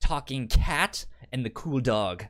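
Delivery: normal talking voice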